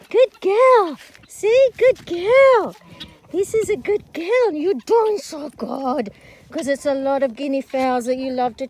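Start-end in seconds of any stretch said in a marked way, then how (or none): 0.1-4.5 high praise. Real, not acted. Soothing praise to dog. 4.5-6.1 slow praise. Real, not acted. Praising dog slowly.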